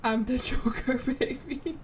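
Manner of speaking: put-on Southern-accented villain line broken by a laugh; Southern Joker. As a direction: giggle through a Southern-accented villain boast. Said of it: "Southern Joker?".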